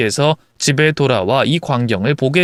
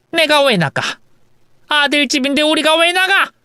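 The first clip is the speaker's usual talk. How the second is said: high, affronted mother-in-law; indignant protest; 12 semitones higher than usual. Acted. Mother-in-law refusing to leave son's house, indignant. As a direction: refuse loftily, voice pitched up.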